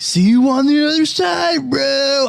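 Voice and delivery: high, slow dudebro mimicry; mock bro voice. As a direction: ham up a cheesy bro farewell. Mocking fans quoted.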